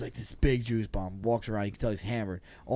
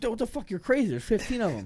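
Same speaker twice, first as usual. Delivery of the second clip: alarmed friend; 9 semitones higher than usual. Retold or imitated. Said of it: "My boy's like".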